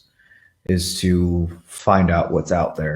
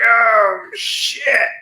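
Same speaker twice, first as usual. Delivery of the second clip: startled; 4 dB louder than usual. Retold or imitated. Reacting to mountain lion video.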